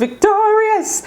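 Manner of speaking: high, slow, laughing cry of victory; laughing triumph. Real, not acted. Tarot reader celebrating a card for her viewers, laughing. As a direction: crow it out through a laugh.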